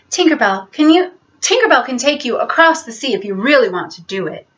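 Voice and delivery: plain reading voice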